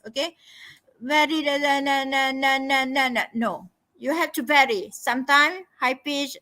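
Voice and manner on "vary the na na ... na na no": droning on one held note; monotonous. Shown as a demonstration. Teacher showing candidates how not to speak, monotonous. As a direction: rattle it off as an unvarying monotone. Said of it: "not monotonous".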